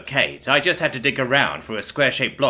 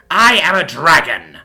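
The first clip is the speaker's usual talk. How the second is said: I am a dragon, slow and much louder; louder insistence; 4 semitones higher, 9 dB louder, slower than usual. Acted. Villain to hero, slow and much louder insistence. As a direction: roar the words, slower and louder.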